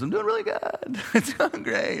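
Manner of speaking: high, bright put-on cheer with a laugh; breezy fib to the boss, masking trouble; fake cheerful lie. Youth pastor to his boss, falsely upbeat and high. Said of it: "and I lied".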